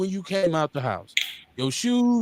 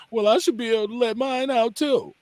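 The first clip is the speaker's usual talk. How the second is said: higher-pitched mock protest; put-on sensitive man's excuse; mocked weak excuse; 6 semitones higher than usual. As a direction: imitate an over-sensitive man. Imitates weepy man's excuse.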